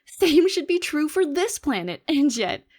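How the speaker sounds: 声を張り上げて、信じられないという驚きで; 高く強く、興奮して言い放つ; astonished outburst. Acted. Alien scientist astonished at humans.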